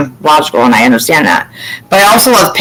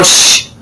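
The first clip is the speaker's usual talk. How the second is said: drawn-out "shh"; acted-out shush. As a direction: hush someone to listen. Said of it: "and I'll go".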